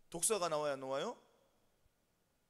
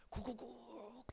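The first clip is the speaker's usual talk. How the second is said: soft, drawn-out mimicked coo; pigeon cooing; 4 semitones higher, 10 dB quieter, much slower than usual. Pigeon crying out from the hole, mimicked cooing. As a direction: imitate a pigeon calling quietly for help. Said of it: "비둘기는 짖기 시작합니다".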